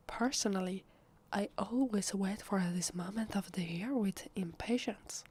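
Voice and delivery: ordinary talk, nothing marked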